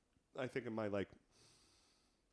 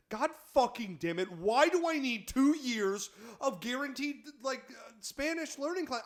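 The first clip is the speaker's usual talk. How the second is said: exasperated; 8 semitones higher than usual. Retold or imitated. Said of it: "It's like".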